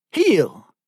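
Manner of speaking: high, sharp one-word order; shouted command. A narrator's reading. Captor jerking the prisoner's chain, shouted command. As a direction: call it out like an order to a dog. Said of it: "jerked hard on the chain and shouted".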